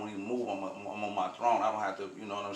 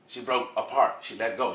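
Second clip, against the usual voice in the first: ordinary talk, nothing marked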